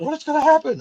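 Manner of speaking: high imitated panic, not fully earnest; mock freaking out. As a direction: squeak it like a scared girl. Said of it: "she was just freaking out".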